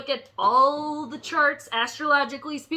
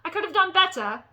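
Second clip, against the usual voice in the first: higher-pitched mock British accent; 7 semitones higher than usual. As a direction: have another go at sounding British. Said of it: "now it's coming on a little better here".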